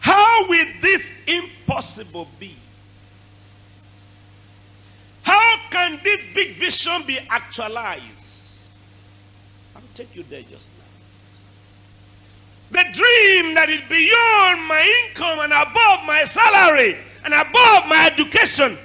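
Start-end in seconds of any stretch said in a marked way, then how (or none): 0.0-8.0 high, slow shouted preaching; full-force preaching. Acted. 12.7-18.8 high, slow shouted preaching; full-force preaching. Acted.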